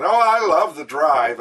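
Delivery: put-on loud, drawn-out Jersey accent; attempted New Jersey accent, slow and emphatic. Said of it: "New Jersey accent".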